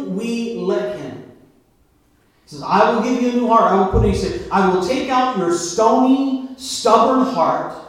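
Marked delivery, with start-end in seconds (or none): 2.7-7.7 louder and higher, proclaimed; preaching God's words. Acted. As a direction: declare it from the pulpit.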